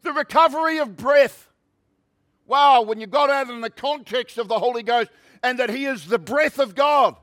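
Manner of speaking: high, loud revival preacher; fervent preaching. Real, not acted. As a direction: proclaim it with rousing, punched key words. Preaches with rising force.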